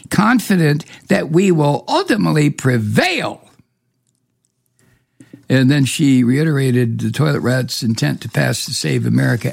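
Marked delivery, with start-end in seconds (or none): 0.1-3.4 higher, exaggerated swooping quote; mocking spokeswoman. Host quoting Trump's spokeswoman, mockingly. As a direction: parody the official's grand pronouncement. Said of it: "she said that the toilet rat was, quote".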